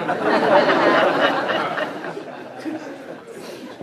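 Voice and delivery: airy laughter at himself; real amused laugh; breathy laugh. Real, not acted. Laughing at own story.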